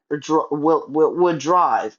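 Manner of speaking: tripping over the words, restarting; stuttering. Real, not acted. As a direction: stumble and repeat the words. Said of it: "Sorry, I'm stuttering a lot".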